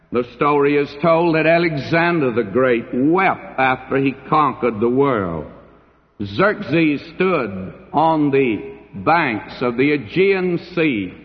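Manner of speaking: usual speaking voice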